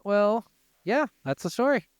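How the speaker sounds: ordinary talk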